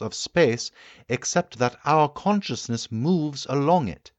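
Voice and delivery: the keen inventor holding forth to his guests; the Time Traveller lecturing. Acted. Time traveller explains his theory.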